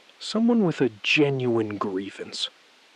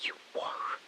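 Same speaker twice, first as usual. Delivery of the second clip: softer, barely voiced; whisper; 14 dB quieter than usual. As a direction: concede it in a whisper. Hushed confirmation.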